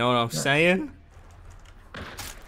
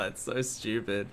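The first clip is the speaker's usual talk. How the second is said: usual speaking voice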